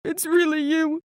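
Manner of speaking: he's found his lost daughter; tearful dad. Retold or imitated. Father crying as he recognizes his daughter, tearful. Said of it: "he started crying and hugging me".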